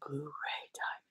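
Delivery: a short whisper to herself; whispered aside. Real, not acted. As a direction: breathe it out like a secret aside.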